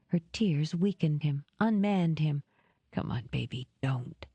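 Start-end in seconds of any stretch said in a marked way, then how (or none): 2.9-4.2 soft, low and tender; soothing. Acted. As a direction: soothe her gently. Soothing her tears.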